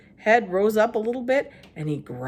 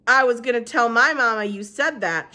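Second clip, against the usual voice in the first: shouted; 6 semitones higher, 4 dB louder than usual. A narrator's reading. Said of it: "shouted Stevie".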